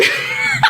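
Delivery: a sudden high shriek of laughter; high squealing laugh. Real, not acted. Host shrieks with laughter at the pastor's story.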